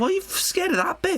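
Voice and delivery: high, shouted disbelief; exasperated mock shout. Retelling her reaction to the child, high exasperated shout. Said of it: "And it's like".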